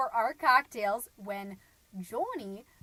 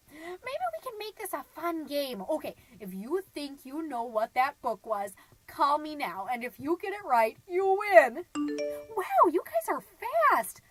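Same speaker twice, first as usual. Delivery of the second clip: high, gleeful announcing that builds louder; excited game host; 4 semitones higher, 7 dB louder than usual. Acted. Mom running a call-in game for listeners, excited. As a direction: build to a loud, high-pitched peak.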